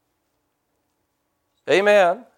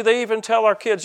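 normal talking voice

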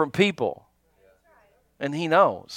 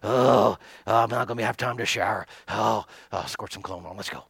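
mock rushed preacher; 9 semitones lower, 5 dB quieter than usual.

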